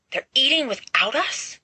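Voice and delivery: high, shocked and indignant; boy outraged the feast started without them; outraged gasp. A narrator's reading. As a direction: gasp it out, appalled. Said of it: "gasped Peter, outraged".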